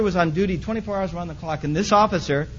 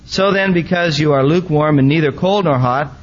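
proclaimed louder, weighty; scripture reading; 8 dB louder than usual. Acted. Preacher reading the letter to Laodicea, loud and proclaiming. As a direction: read it out like a pronouncement.

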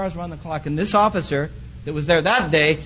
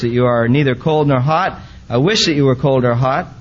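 proclaimed louder, weighty; scripture reading; 8 dB louder than usual. Acted. Preacher reading the letter to Laodicea, loud and proclaiming. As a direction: read it out like a pronouncement.

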